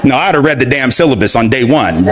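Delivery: ordinary talk, nothing marked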